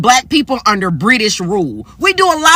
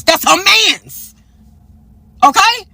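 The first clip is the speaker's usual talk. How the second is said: drawn-out sassy punchline; 7 semitones higher, slower than usual. Real, not acted. Punchline about grandma.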